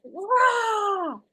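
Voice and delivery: high-pitched mock roar; drawn-out roar of frustration; venting roar. Shown as a demonstration. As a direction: let out a raw rah. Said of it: "just like".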